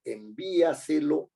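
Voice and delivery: envíaselo said slowly with weight on VÍ; drawn-out example word. Shown as a demonstration. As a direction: stretch out envíaselo, stressing VÍ. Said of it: "El acento está en la anterior".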